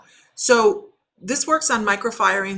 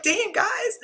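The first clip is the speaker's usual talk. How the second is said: a much higher, laughing damn guys; tossed off in happy disbelief; delighted high surprise at a quick sale; amazed. Retold or imitated. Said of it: "I was like".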